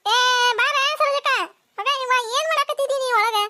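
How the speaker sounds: impatient holler to a neighbour indoors; shouted call. Acted. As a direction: shout for her to come out. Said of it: "ಹಂಗ ಚೀರಾಕತ್ ಬಿಟ್ಟಿಲ್ಲ".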